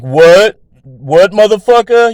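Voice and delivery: mock tough-guy ghost; ghost's challenge. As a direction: square up to him. Ghost getting in his face.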